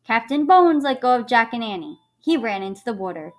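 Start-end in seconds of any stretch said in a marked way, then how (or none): none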